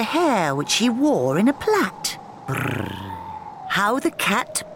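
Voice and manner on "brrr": cat purr. Acted. Cat purring in the story.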